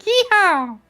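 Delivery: high cowboy holler; cowboy yeehaw. Pretend. Proposed jump sound, high cowboy holler. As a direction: whoop it like a rodeo jump. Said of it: "it should be like".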